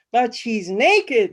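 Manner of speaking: loud, slow, indignant protest; squawking protest. Acted. Flustered guy squawking at the narrator about the naked woman. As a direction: squawk it out in disbelief. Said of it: "flapping and squawking".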